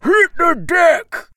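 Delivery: high, slow shouted warning; loud gung-ho soldier. Acted. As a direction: bark it out across the deck. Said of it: "then yelled at me".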